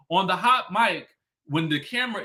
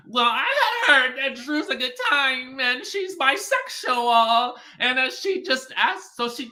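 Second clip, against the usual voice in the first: Courtney impression; 8 semitones higher than usual. Mocking Courtney on the reality show, high and giggly. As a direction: imitate Courtney mumbling through a laugh. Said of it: "in case you could not understand Courtney speak".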